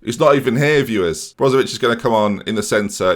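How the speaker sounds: normal talking voice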